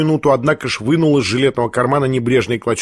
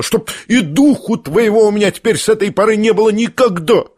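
high-pitched angry banishment; 6 semitones higher than usual. A narrator's reading. Furious woman forbids the prince to ever return, angry. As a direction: banish them in a heated outburst. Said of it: "побледнев от гнева".

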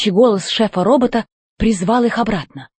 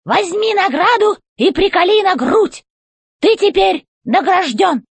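robot chef voice; 10 semitones higher than usual. A narrator's reading. Robot chef demanding answers from the old robot, high and commanding. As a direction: bark orders from up high like a tin general.

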